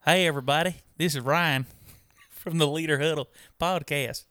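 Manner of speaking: loud, hammed-up southern twang; mock Texan podcast host greeting; co-host's Texas drawl. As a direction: put on a big Texas accent. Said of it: "So I'll be you and you be me".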